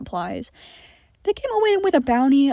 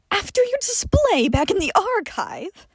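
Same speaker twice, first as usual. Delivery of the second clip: higher, scornful voice; scoffing; 10 semitones higher than usual. Acted. John scoffing at Johnny over the archive, higher and scornful. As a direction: scoff at him down your nose. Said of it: "John scoffed".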